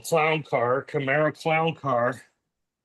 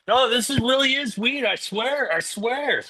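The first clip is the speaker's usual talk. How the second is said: pleading stoner; 8 semitones higher than usual. Retold or imitated. Pleading with a cop, imitated.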